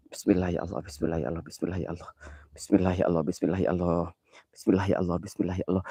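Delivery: low, soft repeated prayer; quiet anxious chanting; murmured prayer. Real, not acted. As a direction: mutter it over and over. Repeated prayer while scared.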